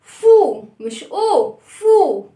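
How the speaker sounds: loud "فو" set against "أو"; teaching contrast of "فو" and "أو"; fu not u. Shown as a demonstration. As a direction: show "فو" starts with f, not "أو". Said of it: "صوتها".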